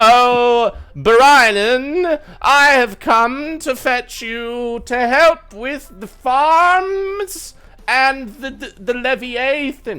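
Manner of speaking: high, loud, very slow calling voice; shield-bearing puppet hero. Acted. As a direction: call out grandly, stretching every word.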